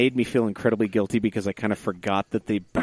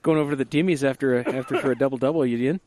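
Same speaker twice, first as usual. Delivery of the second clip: put-on hoser Canadian accent; 4 semitones higher, 3 dB louder, faster than usual. Continuing the Canadian hoser bit.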